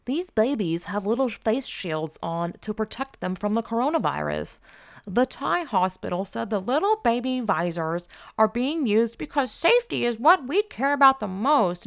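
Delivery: high, exaggerated put-on cutesy read; mocking headline read. Host reads a BuzzFeed headline she finds sick, mocking it. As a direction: read it high and overdone, in mockery.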